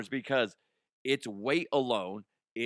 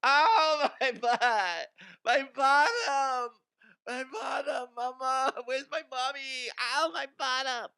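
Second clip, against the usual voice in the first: whiny gored bullfighter; 12 semitones higher, 4 dB louder than usual. Retold or imitated. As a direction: wail for mommy like a hurt crybaby. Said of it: "what would that sound like?".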